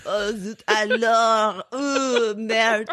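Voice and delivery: hammed-up French exclaiming; mock French dismay; horrible French accent. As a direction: curse like a cartoon French person. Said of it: "horrible French accents".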